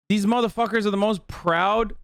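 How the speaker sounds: ordinary delivery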